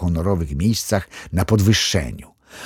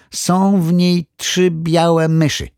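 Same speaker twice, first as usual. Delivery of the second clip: measured pronouncement; 8 semitones higher, 3 dB louder, slower than usual. A narrator's reading. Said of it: "powiedział".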